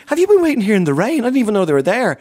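high, loud startled question; surprised concern. Retold or imitated. Comedian retelling his shock at fans waiting in rain, high and loud. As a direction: sound amazed they waited in rain.

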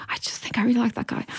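half-whispered, breathy quote; softly whispered retold admission; hushed confession. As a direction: whisper it like a confided crush. Confiding her crush to friend, retold.

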